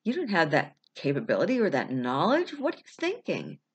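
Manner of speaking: scornful disbelief, swooping sharply up and down; mock someone's ambition with exaggerated rises; incredulous put-down with big pitch swings; belittling critic. Voices someone belittling the listener.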